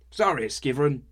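loud, high sorry; shouted apology. A narrator's reading. Herwig shouting an apology to Skivrin, loud. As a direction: shout it while stumbling. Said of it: "the ale assailant shouted".

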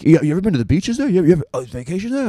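high, loud, eager nosy stranger; gushing excitement. Acted. Mimics eager questioners about Croatia.

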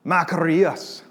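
Greek word with a Scottish lilt; Scottish accent. Real, not acted. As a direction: say "makarios" as if you were Scottish. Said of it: "comes out with a Scottish accent with me".

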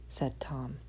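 plain reading voice

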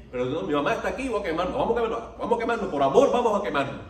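high, loud, rising mock plea; mock desperate protest. Preacher mimics someone staying to burn with mother, mock-desperate. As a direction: act out an absurd, overwrought refusal to escape. Mock refusal to flee burning house.

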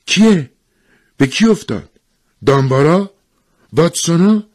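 high, loud eager questions; acted urgent buzz of questions; excited chatter. A narrator's reading. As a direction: voice a crowd of women all asking. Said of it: "همهٔ زنها همزمان به حرف زدن افتادند".

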